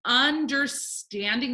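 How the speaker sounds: "Understanding" drawn out in slow parts; syllable by syllable. Shown as a demonstration. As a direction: break "Understanding" up slowly. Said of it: "break it up by the vowel sound".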